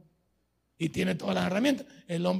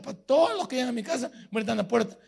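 ordinary talk

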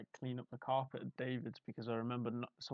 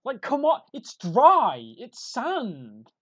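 high, loud outraged rant; mock angry rant; 12 semitones higher, 14 dB louder, slower than usual. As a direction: rant in exaggerated outrage, slowly. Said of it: "that's just made Will really angry".

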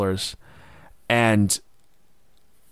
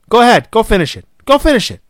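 mock urging, high and loud; 9 semitones higher, 6 dB louder, faster than usual. Voicing the wife urging him on.

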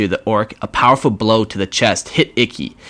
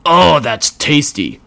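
higher, slow savouring orc; orc bellowing; 6 semitones higher, 3 dB louder, much slower than usual. Acted. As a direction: relish the taste out loud. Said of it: "the Orc bellowed".

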